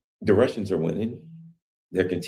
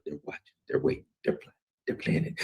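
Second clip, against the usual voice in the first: whisper; 4 semitones lower, 6 dB quieter than usual. Real, not acted. Quiet watcher described.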